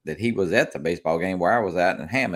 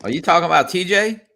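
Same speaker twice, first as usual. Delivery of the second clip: calling out; 11 semitones higher, 7 dB louder than usual. Real, not acted. Loud mock question among friends.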